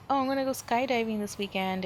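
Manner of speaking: ordinary talk, nothing marked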